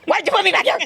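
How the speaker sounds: high, upset complaint at the coach; mock frantic yell at coach Lynn; retold yelling. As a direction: whine and protest at the coach. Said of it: "I'm screaming at her".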